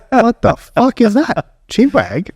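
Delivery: slow, high-pitched incredulous reaction; baffled disbelief. Retold or imitated. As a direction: retell it as drawn-out, high bafflement. Retells bafflement at slang word.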